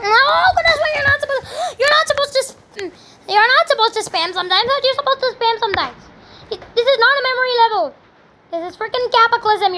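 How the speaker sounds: high, frantic complaining; high-pitched, pleading outburst; exasperated protest. Real, not acted. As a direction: wail at the game in real frustration. Frustrated protest at the game.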